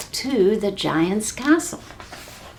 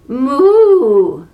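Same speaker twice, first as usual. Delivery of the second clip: loud, higher, sugary cow moo; sweetest moo; 5 semitones higher, 7 dB louder than usual. Acted. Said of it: "in her sweetest voice".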